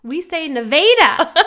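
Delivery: proud Iowa "Nevada" with VAY; local pronunciation. Shown as a demonstration. Telling a friend how Iowans say it, "Nevada" as nuh-VAY-duh. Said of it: "like the state Nevada".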